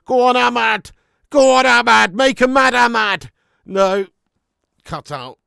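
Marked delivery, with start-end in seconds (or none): none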